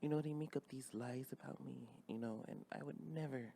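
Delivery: soft, hushed [name]. Pretend. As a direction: plead gently like [name].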